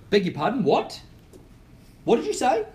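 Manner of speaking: rising outraged disbelief, pitch climbing high; shocked seller. Retold or imitated. Seller reacting to a swearing agent, shocked and rising. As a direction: play the offended homeowner, voice shooting up. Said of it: "they would all of a sudden go".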